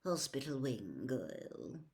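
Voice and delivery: level, quiet order; calm. A narrator's reading. Snape sending Goyle off, calm quiet order. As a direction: say it coolly. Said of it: "Snape said calmly".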